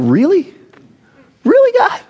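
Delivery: exasperated at God, voice jumping up; incredulous. Pretend. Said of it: "You're like".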